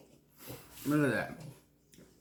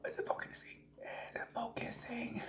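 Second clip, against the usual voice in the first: breathy whisper, barely voiced; 11 semitones higher, 14 dB quieter than usual. Real, not acted. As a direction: hush it, as if not to disturb the shot. Checking camera focus quietly.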